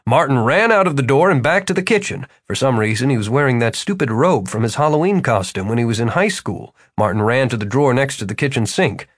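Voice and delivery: ordinary delivery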